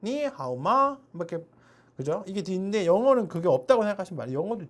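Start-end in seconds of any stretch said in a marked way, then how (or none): 0.0-1.0 니하오마 with a raised Chinese tune; Chinese tones. Shown as a demonstration. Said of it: "높낮이 그죠?". (니하오마 중국어 성조로)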